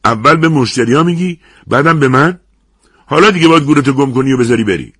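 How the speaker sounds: loud, high dressing-down; angry shout. A narrator's reading. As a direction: yell it right in their face. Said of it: "توی صورتم داد زد".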